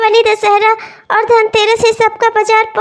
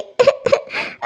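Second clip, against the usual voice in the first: breathy sob; 2 semitones higher, 7 dB quieter than usual. Acted. Crying to brother-in-law.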